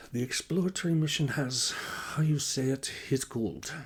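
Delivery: put-on earnest Nordic-accented English, feeling for the idiom; Lena Nordstrom. A narrator's reading. Scientist reporting the Mars find, earnest Northern European accent. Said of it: "in an accent colored by the European North".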